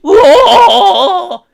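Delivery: hammed-up villain roar; monster roar. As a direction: roar like a beast torturing its prey. Sauron's torture roar.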